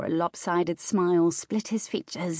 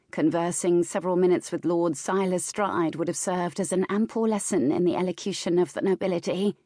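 plain reading voice

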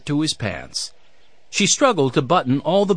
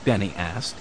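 plain reading voice

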